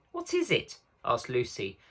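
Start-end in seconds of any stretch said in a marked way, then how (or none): 0.1-0.8 high, forced-calm question; trying not to sound nervous. A narrator's reading. Lucy asking about the creature, nervous. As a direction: hide the fear in your voice. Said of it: "trying very hard not to sound nervous".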